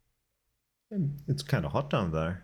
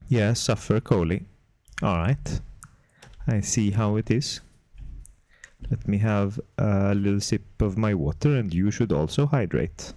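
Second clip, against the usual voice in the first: mock Swedish accent, words picked with care; careful chat in a badly faked Swedish accent; put-on Swedish accent, close on the mic; terrible Swedish accent; 4 dB louder than usual. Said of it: "With Swedish accent".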